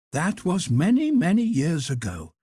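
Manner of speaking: high, brisk matronly hotel cook; head cook voice. Acted. Head cook offering Carl a job, high and bustling. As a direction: lift the pitch high for the kindly head cook.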